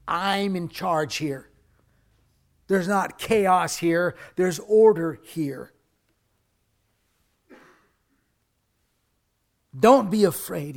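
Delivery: loud, slow, firm declaration; preacher booming God's words; commanding proclamation. Acted. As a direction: proclaim it with authority.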